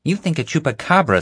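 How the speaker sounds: snappy comeback in the narrator's near-own voice; mild mocking retort. A narrator's reading. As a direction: throw the question right back at him. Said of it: "Xavier retorted".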